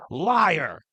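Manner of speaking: loud, falling mock accusation; hammed-up angry cry; accusing outburst. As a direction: imitate an old man flinging an accusation. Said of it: "just leans in him and goes".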